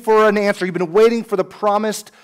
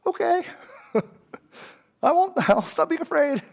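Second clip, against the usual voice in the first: laughing through the words, higher; 10 semitones higher, 4 dB quieter than usual. Real, not acted. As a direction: chuckle it out as a playful reply. Laughing aside to congregation.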